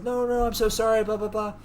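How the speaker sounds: high-pitched imitation of pleading; frantic apology. She placates the upset man, mock frantic apology.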